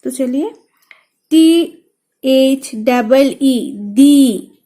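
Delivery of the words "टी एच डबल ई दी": slow spelling, then दी said loud and stressed; stressed dhi. Shown as a demonstration. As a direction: spell it slowly and land hard on दी. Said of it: "दी लेते वक्त stress feel हुआ".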